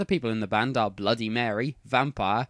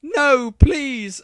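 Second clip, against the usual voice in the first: high, loud desperate plea; begging; 12 semitones higher, 9 dB louder than usual. A narrator's reading. Heroine pleading with Voldemort, begging. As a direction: cry out and beg for mercy. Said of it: "I begged".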